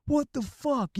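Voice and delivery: very high, shocked outburst; shocked what the fuck. Retold or imitated. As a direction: burst out in disbelief. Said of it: "I'm like".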